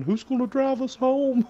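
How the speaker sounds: very high-pitched retold screaming; mock panicked scream. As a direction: cry out shrilly, imitating yourself in a panic. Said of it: "I'm in the background screaming".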